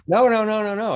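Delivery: high-pitched run of protests; rapid-fire mock protest, high and insistent; imitated hasty denial. Director hastily denying, retold.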